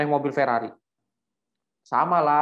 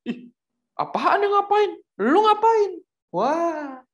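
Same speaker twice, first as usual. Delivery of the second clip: much higher, slow indignant yelp; startled comeback; 5 dB louder, much slower than usual. Retold or imitated. Startled driver checking his mirror yells back at Asoy, high indignant. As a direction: shoot back surprised and offended.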